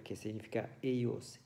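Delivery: ordinary talk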